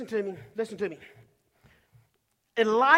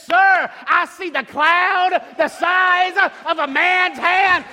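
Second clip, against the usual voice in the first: loud, high, drawn-out preacher's climax; full-force preaching; 4 semitones higher, 5 dB louder, slower than usual. Acted. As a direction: build to the climax, stretching the key words.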